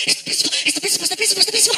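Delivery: rising, frantic repeated whisper; panicked kid. Retold or imitated. Son warning dad the principal is coming, panicked. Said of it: "'cause he's like".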